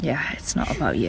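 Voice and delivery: softly whispered aside. Real, not acted.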